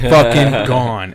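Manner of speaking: slow, stretched out fucking gone; drawn-out punchline. Real, not acted. Telling friends the dog ran off, slow drawn delivery. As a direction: let the words hang, slowly.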